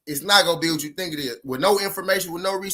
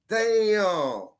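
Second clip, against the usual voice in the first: long drawn-out, higher-pitched amazement; retold stunned reaction; 4 semitones higher than usual. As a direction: re-enact being floored, stretching the word. His stunned reaction to her confession.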